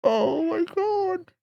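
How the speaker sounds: high stretched oh my God; shocked. Real, not acted. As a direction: gasp it out in disbelief. Reacting to bad old mic audio.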